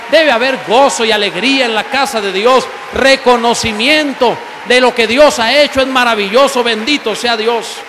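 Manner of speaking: loud, fervent preaching to the congregation; loud sermon shout; full-force preaching. Acted. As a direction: proclaim it loud like a revival preacher.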